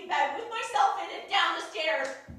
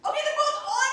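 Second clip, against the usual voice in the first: laughing; 12 semitones higher, 7 dB louder than usual. Acted. Laughing panicked reply.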